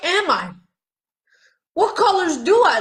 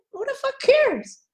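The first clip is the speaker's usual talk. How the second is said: defiant outburst; 11 semitones higher than usual. Real, not acted. Shrugging off others' judgment.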